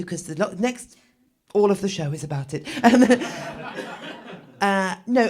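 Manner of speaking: ordinary talk, nothing marked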